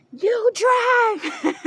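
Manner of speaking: high, loud screech of an order; mock freaking out. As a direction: imitate a panicked mom shrieking orders. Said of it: "she's freaking out".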